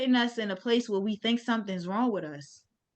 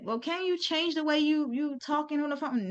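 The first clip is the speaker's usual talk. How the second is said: higher-pitched, softer voice for the manager; imitated manager; 6 semitones higher, 3 dB quieter than usual. Manager asking an employee to change her phone voice, imitated higher and softer. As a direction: mimic the boss in a higher, quieter voice. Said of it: "who was an Italian woman".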